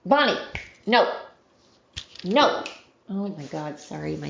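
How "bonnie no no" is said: higher, sudden pet scolding; calling off the dog. Real, not acted. Owner to her dog Bonnie, scolding. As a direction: call sharply to a misbehaving dog.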